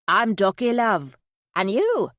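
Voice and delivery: put-on Yorkshire accent, a little lifted and loud; Minnie. A narrator's reading. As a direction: answer chirpily in a Yorkshire accent. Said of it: "Minnie's Yorkshire accent".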